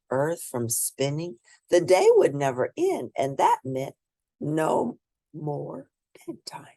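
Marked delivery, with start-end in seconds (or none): 4.4-6.7 slow whispered reveal; dramatic whisper. Acted. Narrator whispers Elliot's secret plan for no bedtime. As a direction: breathe the words like a secret plan.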